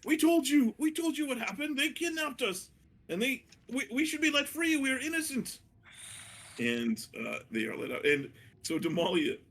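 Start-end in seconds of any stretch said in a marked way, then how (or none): 0.0-5.6 very high-pitched mock protest; hammed-up prisoners' outcry, high voice; captured crew shouting out. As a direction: plead innocence in a high, strained cry. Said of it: "You do hear them shouting out".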